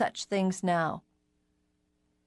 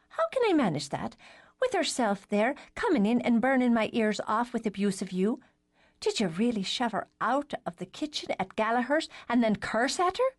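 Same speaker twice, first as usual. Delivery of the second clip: put-on high, girlish voice; light teen girl voice; little sister voice; 4 semitones higher than usual. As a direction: play the cheeky youngest sister. Alice May questions Brenna.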